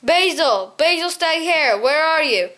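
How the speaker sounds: understated shout for a missing friend; mild shout. A narrator's reading. Young mouse calling Basil amid a fight, mild shout. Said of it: "he shouted at the top of his voice".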